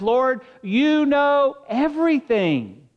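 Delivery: mild grieved plea, slow; a touch of hurt in Peter's answer, slowed. Acted. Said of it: "he is now grieved".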